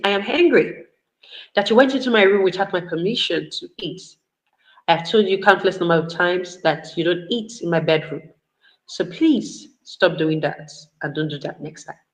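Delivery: soft, measured, controlled complaint; composed and firm, lowering to a soft request; calm assertiveness. Acted. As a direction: state anger quietly, without yelling. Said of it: "There's a better way to say it".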